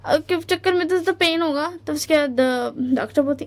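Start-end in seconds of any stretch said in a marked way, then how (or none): none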